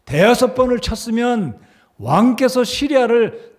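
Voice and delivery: high, loud acted reproach; enraged Elisha. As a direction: scold the king with rising outrage. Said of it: "엘리사가 대노를 합니다".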